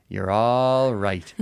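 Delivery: drawn-out Irish "all"; mock-Irish comforting, stretched out; Irish reassurance. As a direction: soothe a child in an Irish lilt, very slowly. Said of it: "in Ireland, they say this to the children".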